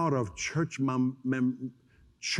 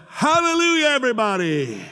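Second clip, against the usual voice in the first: showy praise shout; 8 semitones higher, 5 dB louder than usual. Retold or imitated. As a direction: burst onstage with a mock-jubilant cry. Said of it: "that is the biggest charade".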